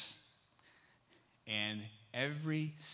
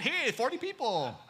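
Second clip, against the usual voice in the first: high, loud comic call-out; spring it on them brightly, exaggerated; mock cheery announcement; 12 semitones higher, 6 dB louder than usual. Mock late-night call to restaurant.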